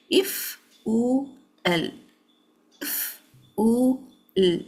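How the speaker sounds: "if u l" as three slow, isolated sounds; sounds separated. Shown as a demonstration. Teacher breaking down fa, u and lam, sounds separated. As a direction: draw out "if", "u", "l" one by one. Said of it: "give the fa sound, then the u sound".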